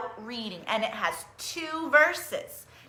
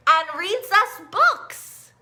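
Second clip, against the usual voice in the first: slow storybook reading; 7 semitones higher, 5 dB louder, much slower than usual. A narrator's reading. Reading picture book aloud.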